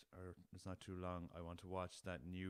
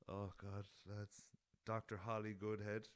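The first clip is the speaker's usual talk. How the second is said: normal talking voice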